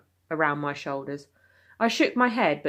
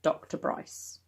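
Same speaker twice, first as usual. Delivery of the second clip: usual speaking voice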